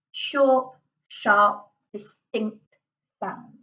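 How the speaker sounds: each of "short, sharp, distinct sounds" chopped off separately; short, separated bursts, dying away on "sounds"; staccato. Shown as a demonstration. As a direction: punch out word by word, pausing between.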